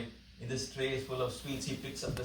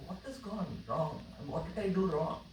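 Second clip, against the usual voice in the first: quiet dismay; 4 semitones higher, 4 dB quieter than usual. Retold or imitated. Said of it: "I'm like".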